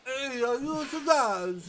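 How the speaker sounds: slow, lips-pursed Godfather mumble; a hint of an old Godfather; Brando-style old man. Applicant purses lips for the greeting, Brando-style Godfather voice. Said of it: "That was like a Brando style".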